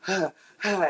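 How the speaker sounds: huffing and puffing for breath; mock panting. Singer arrives backstage after the sprint, mock panting. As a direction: pant like you just ran a quarter mile. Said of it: "I'm huffing and puffing".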